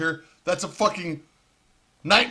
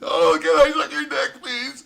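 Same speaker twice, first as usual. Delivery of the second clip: whiny, higher, pretend-weeping liberal; mocking crybaby; 8 semitones higher than usual. Mocking protesters in a whiny, higher voice. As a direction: lampoon a sobbing protester. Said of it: "for them motherfuckers".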